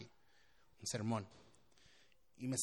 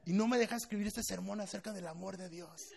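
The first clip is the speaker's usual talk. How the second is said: high-pitched comic exasperation; frustrated; 6 semitones higher, 6 dB louder than usual. Retold or imitated. Pastor inwardly wishing the girl away, comically frustrated. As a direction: vent in hammed-up frustration, half laughing. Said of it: "Ya estaba frustrado".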